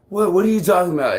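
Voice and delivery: high, loud and fast, baffled; incredulous reply. Retold or imitated. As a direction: fire it back in disbelief. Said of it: "And then I'm like".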